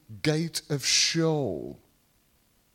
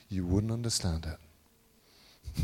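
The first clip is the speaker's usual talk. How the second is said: lightly acted answer, low and softer; knowing answer; 10 semitones lower, 5 dB quieter, slower than usual. Believer answering puzzled questioners, low slow reply. Voices answer in imagined dialogue.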